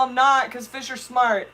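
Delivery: ordinary delivery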